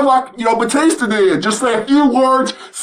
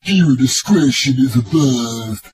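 much lower, mock-ominous warning voice; deep announcer voice; 12 semitones lower than usual. Vlogger warns viewers, in a deep mock announcer voice. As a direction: boom out the warning like a trailer narrator.

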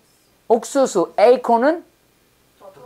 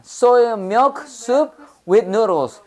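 slow model reading; 2 semitones higher, much slower than usual. Shown as a demonstration. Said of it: "콩국수 뭐였습니까?".

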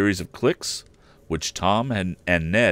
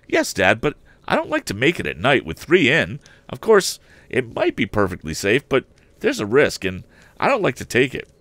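put-on much higher boyish voice, urgent; a keen lad pressing his father; eager young Tom; 6 semitones higher, 4 dB louder than usual. Tom questions his father about the theft, high and eager. Tom's voice again.

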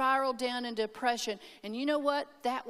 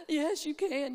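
gasp, then a higher, lightly tearful reply; mock tearful; 4 semitones higher, slower than usual. Crying daughter answering a fatherly friend, mock tearful. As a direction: imitate answering through tears. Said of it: "I was just crying and crying".

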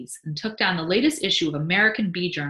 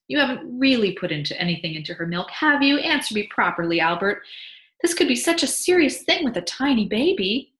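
higher, louder worried mother; anxious wife; 7 semitones higher, 6 dB louder than usual. Acted. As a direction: insist he answer properly. Mabel voiced, pleading.

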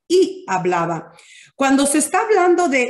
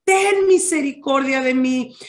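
high-pitched imitated call, easing down; insistent beggar's cry, acted with restraint; retold insistent shout; 5 semitones higher than usual. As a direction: plead over those hushing you, half-shouted. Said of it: "dice que gritaba con más fuerza".

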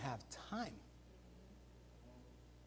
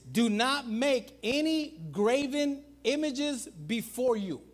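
declaiming scripture; 5 semitones higher, 3 dB louder, slower than usual. Acted. Quoting commandment, finally recalled.

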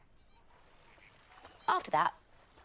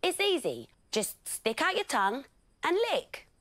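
eager lions; 12 semitones higher, 5 dB louder than usual. Acted. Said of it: "said the lions".

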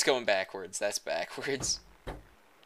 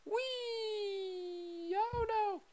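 very high squeaky excited character; squeaky cartoon voice; 5 dB quieter, much slower than usual. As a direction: voice a giddy little cartoon kid.

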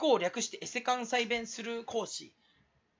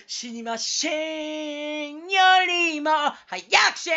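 語尾を引き伸ばして高く叫ぶ; 急に声を張り上げ、大げさに; 芝居がかった絶叫; 7 semitones higher, 8 dB louder than usual. Acted. 突然芝居がかって叫ぶ. (芝居がかった高い絶叫で)

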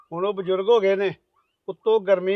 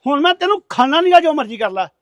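loud, higher furious orders; angry shouting; 4 semitones higher, 6 dB louder than usual. Acted. Husband yelling at his wife to shut up, angry and loud. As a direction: shout her down in anger. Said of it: "ਇੰਨਾ ਗੁੱਸੇ 'ਚ ਨਾ ਆਇਆ ਕਰੋ".

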